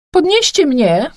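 slow, high, loud command; imperious high-pitched shout; demanding call. A narrator's reading. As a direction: call out an order to be lifted. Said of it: "rzuciłam w kierunku".

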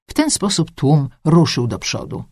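usual speaking voice

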